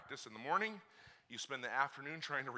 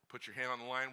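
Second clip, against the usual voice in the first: ordinary talk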